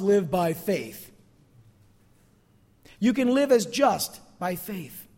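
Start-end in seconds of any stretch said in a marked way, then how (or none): none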